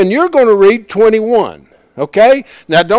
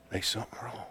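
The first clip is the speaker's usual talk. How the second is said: ordinary talk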